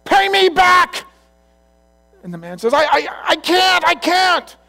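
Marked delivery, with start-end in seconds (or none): 0.1-1.0 very high, loud shout; furious demand. Acted. Servant choking a man who owes him, furious shouting. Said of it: "He's choking him". 2.7-4.6 high, loud, stammered cry; panicked protest. Acted. Choked debtor answering his attacker, panicked shouting.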